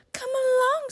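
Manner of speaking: high squeaky little mouse voice; bright squeak of an eager mouse. Acted. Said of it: "squeaks Mouse".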